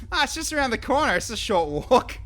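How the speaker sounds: sarcastic. Real, not acted. Sarcastic about huge distance.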